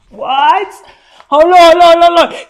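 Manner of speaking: high, loud astonishment; stunned disbelief. Real, not acted. Reacting to a line in the book, loudly stunned. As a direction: stop everything in shock. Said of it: "reacting to the book passage".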